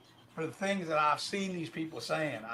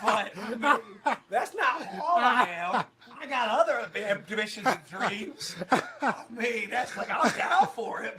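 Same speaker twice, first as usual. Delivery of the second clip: laughing; 6 semitones higher, slower than usual. Real, not acted. Laughing through his lines.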